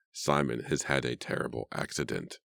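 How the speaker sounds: low, dazed, lightly voiced old man. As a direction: murmur it like a stunned old man. Deranged Edward repeating himself.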